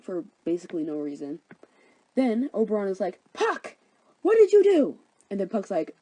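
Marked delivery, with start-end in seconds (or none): 3.3-5.0 high, loud cry of dismay; angry scolding. Acted. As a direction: call the culprit out, exasperated. Oberon scolds Puck for mistake.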